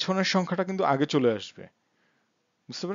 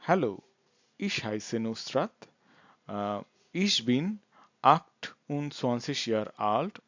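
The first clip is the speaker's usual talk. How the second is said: slow German with gaps between phrases; slow model introduction; much slower than usual. Shown as a demonstration. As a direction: introduce yourself slowly for learners. Teacher models second introduction. (ধীরে থেমে থেমে পরিচয়)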